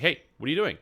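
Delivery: mock call-out. Raid leader calling out teammate.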